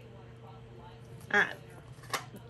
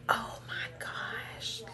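slow, awed whisper under the breath; 3 semitones lower, 5 dB quieter, slower than usual. Real, not acted. Whispering in awe over the gift box. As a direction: breathe it out in wonder.

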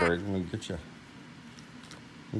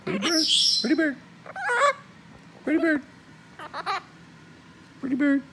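teaching a parrot; 7 semitones higher, much faster than usual. Real, not acted. Drilling the parrot fast.

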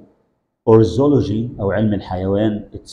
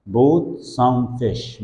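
very slow model phrase; much slower than usual. Shown as a demonstration. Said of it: "teacher models slowly".

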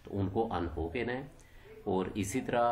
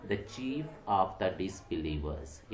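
slow reading; much slower than usual. Shown as a demonstration. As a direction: read the example phrase very slowly. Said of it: "तारुफ का क्या करवाया". (उदाहरण को बहुत धीरे पढ़कर सुनाना)

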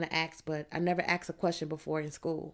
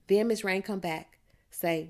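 usual speaking voice

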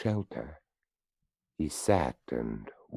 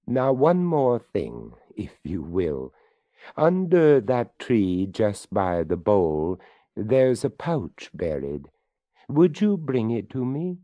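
higher, louder skeleton voice; 6 semitones higher, 7 dB louder than usual. Acted. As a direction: speak up as the courteous skeleton. Skeleton speaks to the boy.

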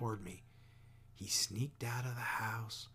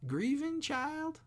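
mock disbelief; 5 dB louder, slower than usual. A narrator's reading. Maudie echoes question in disbelief.

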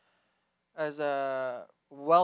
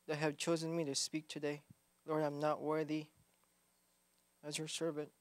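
soft, humble prayer voice; 5 dB quieter than usual. Real, not acted. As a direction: pray quietly and humbly. Opening prayer.

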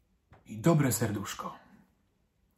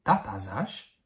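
usual speaking voice

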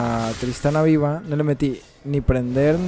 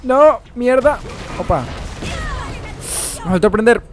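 loud, high annoyed outburst; frustrated gamer; 10 semitones higher, 4 dB louder than usual. Real, not acted. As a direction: curse at the screen, loud. Frustrated at failed play.

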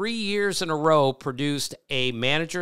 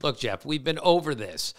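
ordinary talk, nothing marked

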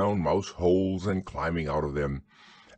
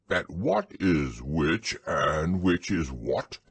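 very slow, measured bear recital; ponderous, deliberate verse reading; poem recitation; 3 semitones lower, slower than usual. Acted. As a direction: recite it solemnly, drawing out each line. Pooh recites his poem.

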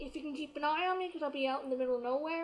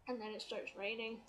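normal talking voice

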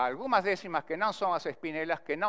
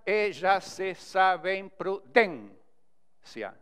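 very slow, separated syllables; syllable-by-syllable scansion; 3 semitones higher, much slower than usual. Shown as a demonstration. Teacher showing a line without final stress, very slow syllable-by-syllable scansion. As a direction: tap out each syllable, stretching the stressed one near the end. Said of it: "quando não termina em palavra aguda".

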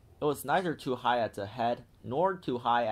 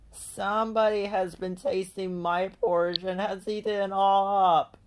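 put-on higher, small wailing voice of the baby bear; little wee bear crying; 8 semitones higher, 3 dB louder than usual. A narrator's reading. Baby bear finds his porridge eaten, wailing. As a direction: whimper it out like a tiny bear. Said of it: "cried the little wee bear in his little wee voice".

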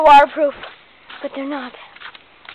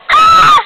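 sudden high shriek; high scream; 5 dB louder than usual. Acted. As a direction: shriek as you fall. Falls while playing.

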